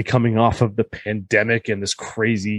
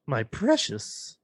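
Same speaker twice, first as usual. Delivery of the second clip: slow, hushed creature voice; Golem impression attempt; 3 semitones higher, 8 dB quieter, much slower than usual. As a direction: draw it out softly like a creature. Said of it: "I can do the Golem voice".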